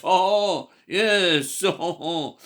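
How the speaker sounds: loud, high, hammed-up French accent. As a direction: play up a mock French accent with gusto. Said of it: "My French accent".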